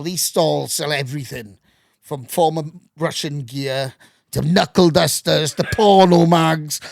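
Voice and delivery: ordinary talk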